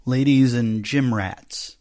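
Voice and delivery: slow, punchy emcee riff; mock announcer. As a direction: stretch it out like a big host. Riffing on the announcer opener.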